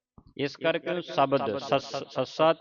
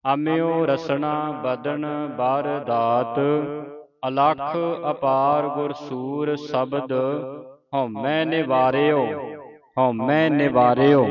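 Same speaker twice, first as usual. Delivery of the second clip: slow, measured Gurbani recitation; scripture recitation; 4 dB louder, slower than usual. Shown as a demonstration. Teacher reciting Gurbani for students to follow, measured chant. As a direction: draw the syllables out in a steady chant.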